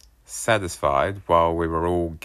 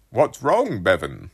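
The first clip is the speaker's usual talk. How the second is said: voiced dad; 3 semitones higher, 6 dB louder than usual. A narrator's reading. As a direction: play the innocent dad, pitched up. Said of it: "Dad said".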